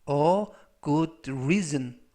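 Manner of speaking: very slow, word by word English; slow dictation reading. Shown as a demonstration. Teacher reads the example sentence for students, very slow. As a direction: read it out slowly so learners follow.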